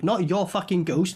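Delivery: ordinary talk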